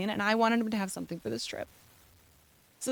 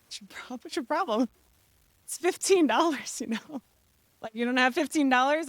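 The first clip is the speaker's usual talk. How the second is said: higher-pitched, challenging mock of a son; indignant challenge; 4 semitones higher than usual. Son asks mother what her problem is, mock-indignant. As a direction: mimic an indignant kid, pitched up.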